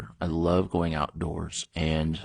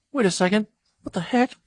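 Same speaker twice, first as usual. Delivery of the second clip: much higher, squeaky startled "what the heck"; mock surprise; 4 dB louder than usual. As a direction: play a coworker catching the mistake. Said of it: "And they're like".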